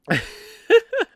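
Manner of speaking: very high breathless giggle; high squeal of laughter. Real, not acted. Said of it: "from laughing this much".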